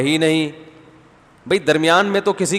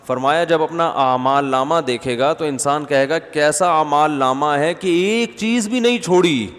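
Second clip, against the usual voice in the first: normal talking voice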